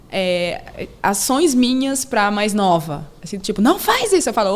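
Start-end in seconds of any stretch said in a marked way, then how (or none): 3.6-4.3 put-on child scolding. Daughter imitates mother to sister.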